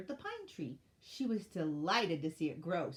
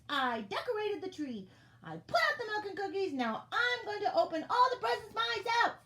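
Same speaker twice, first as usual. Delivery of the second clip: high, loud, emphatic outburst; indignant shouting; 11 semitones higher, 6 dB louder than usual. A narrator's reading. Elf telling off lazy friends who never helped, shouting. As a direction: shout each point, building to a furious high finish. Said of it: "put up her hands and shouted".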